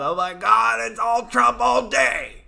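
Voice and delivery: loud, high mocking shout; mock screaming. Jeff Zucker screaming at his newsroom, loud mocking shout. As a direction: yell it like a frantic news boss. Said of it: "up there screaming about".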